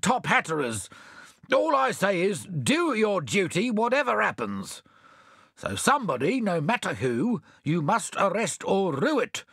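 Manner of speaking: mayor fussing about his robes, lightly pompous. Acted. Mayor ordering the constable, mildly pompous.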